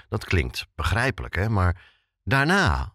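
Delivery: ordinary talk, nothing marked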